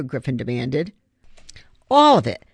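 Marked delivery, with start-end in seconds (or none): 1.9-2.4 higher and louder, breezy and pleased; cheerful. Acted. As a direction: toss it off brightly. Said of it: "Darren replied cheerfully".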